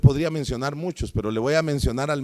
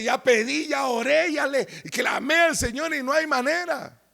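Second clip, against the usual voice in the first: despairing plea; 12 semitones higher than usual. Retold or imitated. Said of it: "a veces uno dice".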